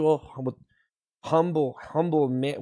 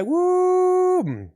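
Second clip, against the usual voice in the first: excited cheer; 12 semitones higher than usual. Acted. Hype cheer.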